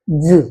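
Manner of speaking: "ज" as a low, buzzing zzz; Z sound. Shown as a demonstration. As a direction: buzz "ज" like a z. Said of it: "ऐसा ज का प्रयोग होता है". ("ज" नुक्ते वाला, ज़्ज़)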